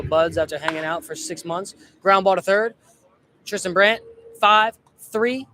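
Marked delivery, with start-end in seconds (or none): 2.0-5.5 louder, higher call of the grounder; rising excitement as the play unfolds; higher, louder live play-by-play; excited play call. Real, not acted.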